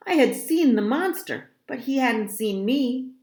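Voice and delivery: ordinary delivery, nothing marked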